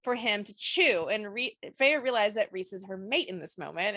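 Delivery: usual speaking voice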